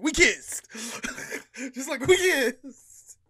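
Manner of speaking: high, loud, laughing burst; laughing reveal. Real, not acted. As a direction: blurt out the secret and crack up.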